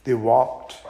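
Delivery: ordinary delivery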